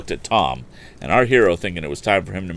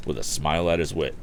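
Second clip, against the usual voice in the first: ordinary delivery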